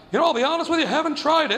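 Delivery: high, polite, nervous answer; nervous earnest kid. Retold or imitated. Scared new inmate politely answering the threat, high and earnest. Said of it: "'cause I was like".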